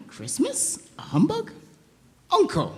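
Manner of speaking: can't believe what he just heard; shocked disbelief. Acted. Nephew shocked at uncle's scorn.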